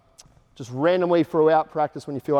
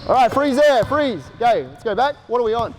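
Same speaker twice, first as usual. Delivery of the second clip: calling out; 5 semitones higher than usual. Real, not acted. Coach stopping the drill.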